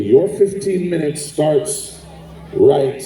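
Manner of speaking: ordinary talk, nothing marked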